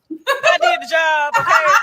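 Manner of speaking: emphatic loud. Real, not acted. Host defends doing her job, loud and emphatic. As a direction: slam it down: I did the job.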